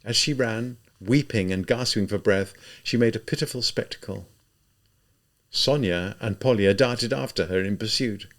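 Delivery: plain reading voice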